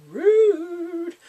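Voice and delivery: loud, high, drawn-out squawk; mock offence. Viewer reacting to the friend's name-calling, mock indignation. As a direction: stretch it out in a high indignant cry.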